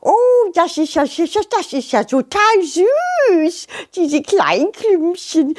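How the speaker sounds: high, gushing butterfly voice; delighted butterfly. Acted. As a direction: coo high over something tiny, stretching key words.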